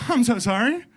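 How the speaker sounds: high, loud apologetic instructor; hammed-up sorry voice; mock apology. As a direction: imitate a flustered guy owning up. Retold instructor apologises for his blunder.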